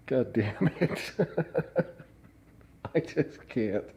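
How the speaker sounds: helpless giggle; laughing curse. Real, not acted. Giving up, laughing.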